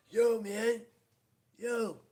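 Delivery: loud high street call, Yo man; calling out loudly. Retold or imitated. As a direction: shout it like hailing someone at a car window. Retelling a stranger calling out.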